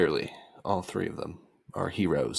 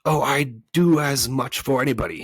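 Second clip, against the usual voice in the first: higher, louder creature showing off; boastful monster voice; 7 semitones higher, 8 dB louder than usual. A narrator's reading. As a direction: play the self-proclaimed monster. The voice character replies.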